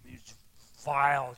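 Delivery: usual speaking voice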